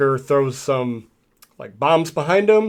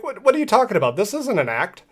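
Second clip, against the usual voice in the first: much higher, incredulous; a mock-baffled hero pitched way up; 8 semitones higher, faster than usual. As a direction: hero voice, high and disbelieving. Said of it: "He's like".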